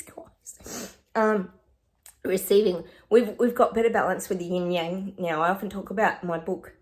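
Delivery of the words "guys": whispering. Real, not acted. Whispered after sneeze.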